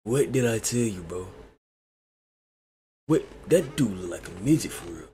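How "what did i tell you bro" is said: ordinary talk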